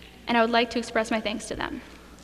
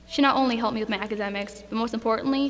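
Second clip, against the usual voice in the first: ordinary delivery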